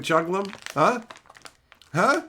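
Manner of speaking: ordinary talk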